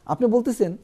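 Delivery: ordinary talk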